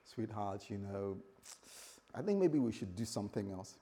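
soft, low, gently tactful; calm nice wife. Acted. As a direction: act a patient wife easing him off an idea. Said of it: "calm, she'll say".

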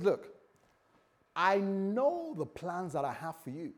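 lifted pitch on "I know the plans", stated with certainty; emphatic. Retold or imitated. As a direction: lean on "know" as a firm assurance. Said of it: "tells us emphatically".

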